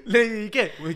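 high, slow laughing squeal over malaza. Real, not acted. Gossiping with the host about Lady Di, laughing high squeal. Mocking Lady Di's secret-keeping.

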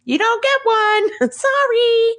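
very high, mock-sweet kid voice; high-pitched, gleefully fake apology; taunting little girl. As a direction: taunt Dad in a drawn-out little girl's singsong. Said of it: "She's like".

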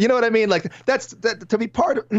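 overplayed sentimental gratitude to podcast listeners; mock choked up. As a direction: pretend to be choking up with emotion. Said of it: "I'm getting choked up here".

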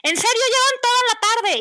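high, loud outraged scolding; exasperated yell. Retold or imitated. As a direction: shout in disbelief at the kids. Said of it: "Estoy así". (grito de mamá exasperada)

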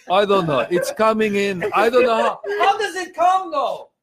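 hammed-up confused weatherman; bewildered Armenian meteorologist. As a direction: fumble the forecast like a lost old man. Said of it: "What would he say?".

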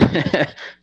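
high, loud burst of laughter; a real laugh breaking out mid-story; amused laugh. Real, not acted. Laughs at his childhood misdeeds.

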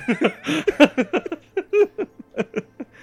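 big high burst of laughter; loud high laugh. Real, not acted.

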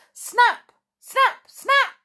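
high, loud excited card-game shouts; yelling children. Acted. Children playing a noisy game of Snap, yelling. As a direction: yell it sharply in a high child's voice. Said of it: "yelled the children".

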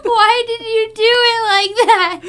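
high, loud comic wail; mock anguished plea. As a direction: wail the question in hammed-up despair. Mock pleading question to the actor.

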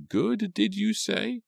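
very high, slow query; stretched, high-pitched questioning; suspicious housekeeper. A narrator's reading. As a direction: draw it out high in disbelief.

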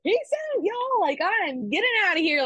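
high, sing-song peace out; cheeky farewell. Retold or imitated. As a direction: gloat playfully to coworkers. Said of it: "and I was like".

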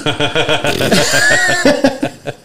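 a real amused laugh. Real, not acted. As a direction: burst out laughing at the memory. Recalling the funny slogan.

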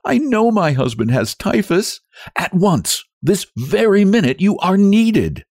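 high, insistent appeal; frantic wife's high-pitched demand; crying out. A narrator's reading. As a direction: insist desperately, drawing out the last words. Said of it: "cried Nellie".

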